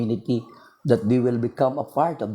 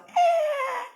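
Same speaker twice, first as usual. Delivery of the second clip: sudden very high mock infant wail; baby's wail. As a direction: cry out like a baby being cut. Imitating a crying baby.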